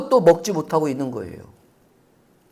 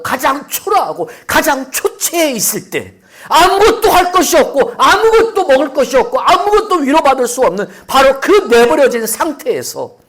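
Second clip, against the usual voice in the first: building sermon climax; 11 semitones higher, 9 dB louder than usual. Acted. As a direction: build each line louder and higher. Preaching at full force.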